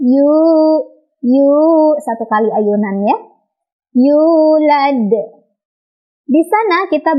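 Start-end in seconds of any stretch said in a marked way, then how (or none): 0.1-1.5 "yu" with the long u given one swing; sway once through the u of "yu"; swung long vowel. Shown as a demonstration. Teacher offers a swung alternative, lengthened "yu". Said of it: "atau diayun". 4.0-5.1 "Yulad" with a long u and bounced final d; lengthened vowel. Shown as a demonstration. Teacher models the whole word, long-vowelled "Yulad". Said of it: "dipanjangkan dua ketukan".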